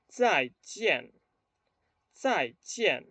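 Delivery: "Zai jian" as two separate falling syllables; model pronunciation. Shown as a demonstration. Teacher has students read along, careful model pronunciation. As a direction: say "Zai jian" distinctly, each syllable dropping. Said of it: "primero a pronunciar estas palabras".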